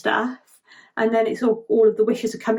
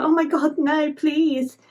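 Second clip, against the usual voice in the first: acted pleading; 6 semitones higher, slower than usual. Acted pleading over fallen star.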